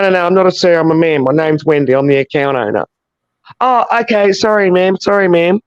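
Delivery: usual speaking voice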